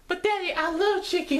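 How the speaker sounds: put-on squeaky high child voice, way up; little girl voice. Girl whining to her dad about chicken, high child voice. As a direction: play the little girl pleading with Daddy.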